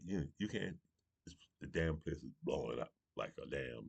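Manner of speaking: ordinary delivery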